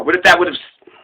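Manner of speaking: usual speaking voice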